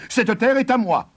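high, insistent claim of ownership; defiant. A narrator's reading. Farmer tells the giant the land is his, defiant. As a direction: assert it stubbornly, pitch right up.

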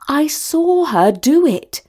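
high, slow, emphatic accusation; exclaiming. A narrator's reading. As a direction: exclaim it indignantly, drawing out the words. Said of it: "exclaimed Talia".

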